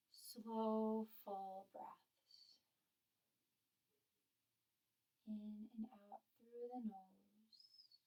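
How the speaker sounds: slow, hushed breathing cues with long pauses; guided meditation. Real, not acted.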